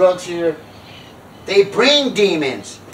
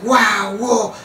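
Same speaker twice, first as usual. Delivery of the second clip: higher, awed onlookers; mock amazement; 4 semitones higher than usual. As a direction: imitate an impressed crowd. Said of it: "everybody's like".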